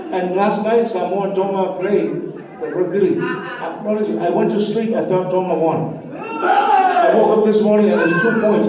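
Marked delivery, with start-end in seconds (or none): 6.2-8.7 excited outburst. Real, not acted. Rugby score outrage.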